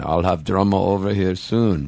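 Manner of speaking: a hint of a bad Swedish accent for the studio owner; terrible Swedish accent. Guest imitating his Swedish friend in a terrible Swedish accent. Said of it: "which is a terrible Swedish accent".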